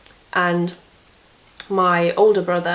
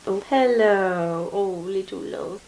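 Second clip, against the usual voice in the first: tender pet greeting. Real, not acted. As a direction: coo gently to the little one.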